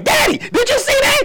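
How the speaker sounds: put-on high excited kid asking daddy; kid son's voice. Youngest son asking daddy during the movie, high and eager. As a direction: squeak it up like a little boy. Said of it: "He be like".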